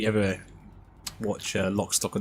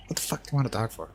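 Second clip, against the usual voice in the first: Irish Pikey line; 3 semitones higher, faster than usual. Retold or imitated. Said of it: "Plays the Irish Pikey".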